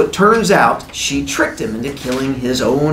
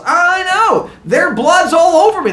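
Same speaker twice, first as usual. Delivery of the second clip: high, hammed-up shout; yelling over screams; 3 dB louder than usual. Bloodied man to screaming villagers, high yell. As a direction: holler obliviously over the screaming.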